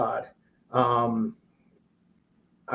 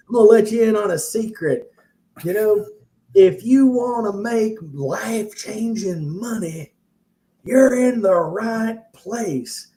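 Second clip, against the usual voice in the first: higher, slow Southern hype-man drawl, lightly done; Southern guru selling the dream, slow and raised; hint of a Southern preacher; 6 semitones higher, 4 dB louder than usual. Guru pitching a crowd of investors, a light Southern preacher lilt. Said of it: "he had a little bit of a Southern".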